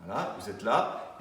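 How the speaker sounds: ordinary delivery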